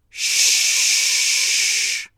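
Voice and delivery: long voiceless "ش", air pushed out as "shhh"; sustained sh sound. Shown as a demonstration. Teacher has learners feel the air on their palm, long sh sound. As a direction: hold "ش" steadily with no voice. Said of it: "با من این صداها رو تولید کنید".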